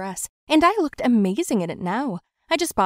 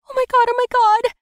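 very high, loud panicked cry; panicked yell; 9 dB louder than usual. Acted. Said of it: "I yelled under my breath".

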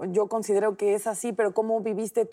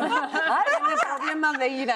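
high, laughing tease; real laughter while teasing; 6 semitones higher than usual. Real, not acted. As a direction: laugh through a joking jab. Host laughing at guest's anecdote.

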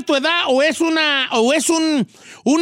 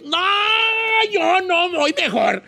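long wailed no, higher and drawn out; mock exasperated refusal; 7 semitones higher than usual. Host refusing to go out after all, mock exasperated refusal. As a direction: give up in a slow, hammed-up wail. Acted refusal climaxing the rant.

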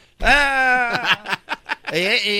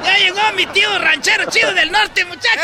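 excited shouting; 8 semitones higher than usual. Acted. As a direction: call the whole family over in delight. Nephew calls out to family. (gritando emocionado)

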